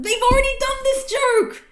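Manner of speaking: very high, full-volume yell; outraged yelling. Acted. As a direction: scream your outrage at the page. Reader yells at repeated joke.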